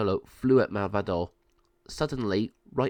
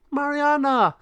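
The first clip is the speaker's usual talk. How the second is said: high, loud call; 6 dB louder than usual. Acted. Bird calling to the captured girl, high and loud. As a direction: call out in alarm.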